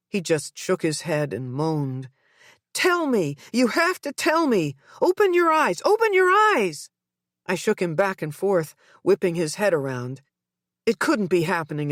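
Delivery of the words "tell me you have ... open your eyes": building from high to very high, louder; desperate mother begging; frantic pleading. A narrator's reading. Mother shaking her son awake, frantic pleading.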